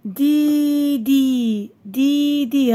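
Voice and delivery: syllable held long and steady; stretched, deliberate reading; drawn-out word reading. Shown as a demonstration. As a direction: sound it out for young pupils. Teacher sounds out word for pupils.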